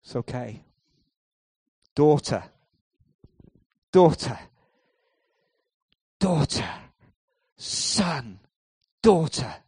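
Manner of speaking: loud, spaced, falling calls of daughter; tender calling. Retold or imitated. Jesus addressing the healed woman, tender and strong. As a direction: call each one home lovingly.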